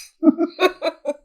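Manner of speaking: short burst of high, loud laughter; self-aware laugh. Real, not acted. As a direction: laugh out loud at your own admission. Laughs at her own admission.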